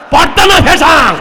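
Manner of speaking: preacher hammering the congregation with the question, loud and high. Real, not acted.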